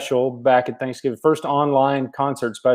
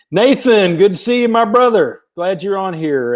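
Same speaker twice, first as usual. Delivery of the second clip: excited greeting; 7 semitones higher than usual. Real, not acted. Greets a church friend warmly.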